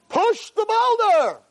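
very high, stretched-out command; voice of Hashem. Acted. Hashem insists to Yankel the farmer, drawn-out heavenly command. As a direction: repeat it as an unyielding heavenly call. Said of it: "he hears a voice".

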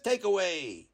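plain reading voice

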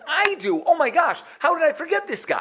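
sudden recollection. Retold or imitated. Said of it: "the butler immediately gets up and says".

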